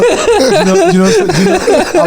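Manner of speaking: high, helpless laughter breaking up the words; laughing. Real, not acted. Guest answering a teasing question, laughing. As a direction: start the sentence over and over through laughter. Cracks up starting his answer.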